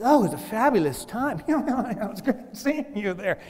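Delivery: high, loud mock small talk; hammed-up social pleasantries; gushing party guest. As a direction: gush like a cocktail-party guest. Mimicking fake cocktail party small talk.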